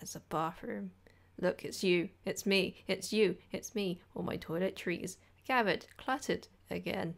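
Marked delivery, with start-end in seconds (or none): none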